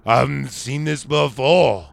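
slow, drawn-out mock sage; crazy-eyed Riverman. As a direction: imitate a crazy-eyed old mystic, stretching the words. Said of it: "those crazy eyes".

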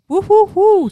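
high, loud whoop for Oxford; cheering woo. Real, not acted. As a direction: cheer it out like a fan.

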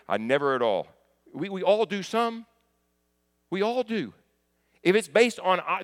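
high, loud pulpit delivery; impassioned preaching. Real, not acted. Preacher challenging the congregation on whose faith wins, impassioned preaching. Preaches at raised pitch and force.

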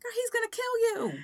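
high and fast, sassy; excited. Real, not acted.

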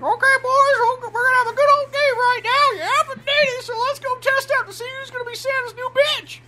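rally the boys in a put-on coach voice; imitating a coach. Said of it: "it's like the coach".

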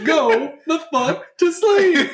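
laughing through the title, slow and high; cracking up while saying it. Real, not acted.

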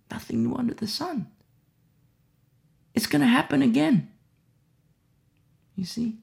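usual speaking voice

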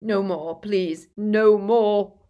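anguished yell, dragged-out plea; drawn-out cry of torment; Dumbledore. A narrator's reading. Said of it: "he yelled again as though his insides were on fire".

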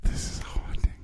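eerie ghostly whisper. Pretend. Ghost bit again.

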